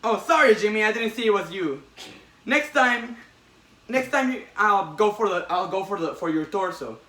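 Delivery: cheery Spider-Man. Retold or imitated. Spider-Man joking to robber.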